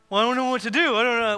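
whiny excuse. Retold or imitated. Congregant's excuse voiced.

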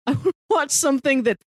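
ordinary talk, nothing marked

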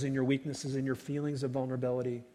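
usual speaking voice

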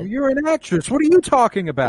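high, loud sneering impression of a boyfriend; mock Sebastian jab. Friend mocking the boyfriend's dinner line, high and loud.

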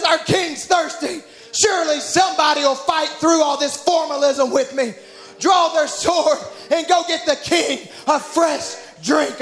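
high, slow preached appeal; preacher's retold plea at full voice; impassioned pleading. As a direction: cry out for someone to step forward. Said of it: "And he just pleads".

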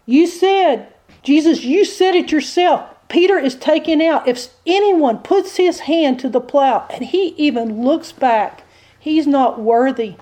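high, emphatic prosecuting devil; accusing devil. Acted. Devil tells Jesus you said it yourself, accusing. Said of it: "The devil presents the evidence".

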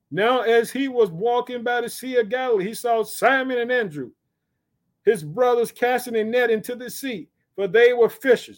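high, emphatic reading voice; scripture declamation. Acted. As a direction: proclaim the story from the pulpit.

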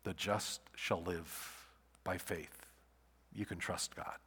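quiet, slow and low; soft slow verse. Shown as a demonstration. Preacher passing the verse to the congregation, soft and slow. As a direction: let it land softly. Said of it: "passed along the message".

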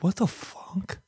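whispered, stunned what the fuck; baffled whisper. Retold or imitated. Taxpayer confused by the forms, whispering in disbelief. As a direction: breathe it out in disbelief. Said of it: "you're not like".

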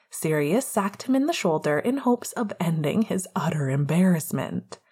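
usual speaking voice

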